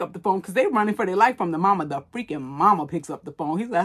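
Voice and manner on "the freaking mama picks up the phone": usual speaking voice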